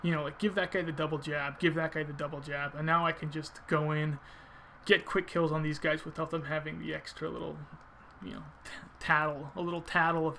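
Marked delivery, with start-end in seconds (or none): none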